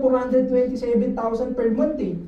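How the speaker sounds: normal talking voice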